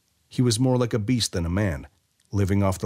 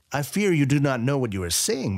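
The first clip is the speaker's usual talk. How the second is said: higher, patronizing commander; condescending general; 6 semitones higher than usual. A narrator's reading. General patronizing Weyra, lightly higher voice. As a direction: talk down to the boy.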